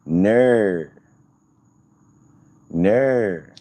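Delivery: "Ner" said as an Australian no with an added r; mock Australian "Ner". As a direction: drawl "Ner" Aussie-style for no. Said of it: "instead of saying no".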